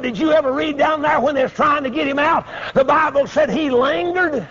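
high-pitched preacherly challenge; pressing question. Retold or imitated. Grilling the men about Lot.

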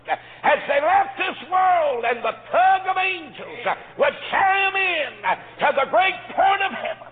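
high, loud sermon chant; soaring preacher's cry; preaching at full force. Acted. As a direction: lift it up like a revival preacher. Preaching climax.

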